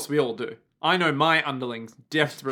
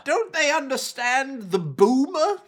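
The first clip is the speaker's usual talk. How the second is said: mock-wistful villain, much higher and slow; 9 semitones higher, slower than usual. Co-host voices the villain pining for boomers, high and slow. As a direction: plead sweetly for the boomers. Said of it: "I just wish everyone could love".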